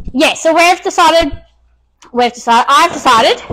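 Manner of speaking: ordinary talk, nothing marked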